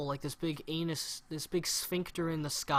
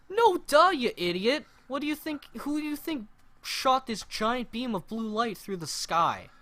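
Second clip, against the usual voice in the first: exasperated retort, much higher and louder at the start; mock exasperated yell; 7 semitones higher, 6 dB louder than usual. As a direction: scold an idiot on screen, half joking. Mocking the hero's obvious line.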